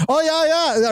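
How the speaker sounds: high-pitched mock Aussie accent. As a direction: imitate an excited Aussie, pitched well up. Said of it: "The Aussie accent".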